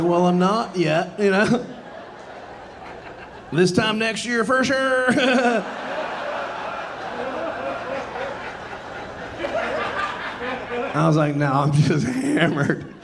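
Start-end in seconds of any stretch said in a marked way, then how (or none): 0.0-5.1 high, slow, giggling through the words; tipsy giggling, pitch climbing; giggly drunk reply. Retold or imitated. 5.1-10.9 long high giggling laugh rising; long breathy high laugh. Real, not acted. Big laugh after punchline. 11.3-12.9 slow words broken by a giggle; tipsy laugh mid-line; drunk giggling. Retold or imitated. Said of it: "can't stop laughing".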